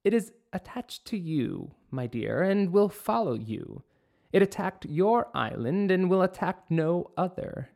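high, grave witch voice; ominous old witch. Acted. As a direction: warn her like a prophetess witch. Witch speaks to the princess.

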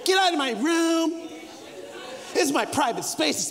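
lightly voiced bratty teenager; sulky teen. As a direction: play the entitled kid.

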